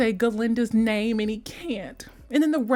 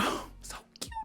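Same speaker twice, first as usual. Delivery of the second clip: tiny breathy whisper; overcome with cuteness; 15 dB quieter, faster than usual. Real, not acted. Gushing over two stars' cameo, whispered. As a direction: sigh it out in a soft whisper.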